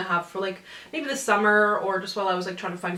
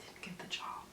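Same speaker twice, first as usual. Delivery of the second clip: deadpan whisper; 21 dB quieter than usual. Real, not acted. Telling viewers the rejection, in a deadpan whisper. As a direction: whisper "Didn't get the job." flatly under the breath. Punchline after hopeful interview story.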